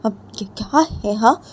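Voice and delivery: high, loud, fast pressing questions; a hurt friend pressing on the phone; urgent pleading. A narrator's reading. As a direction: demand why she won't pick up. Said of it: "जिया वो बोलती".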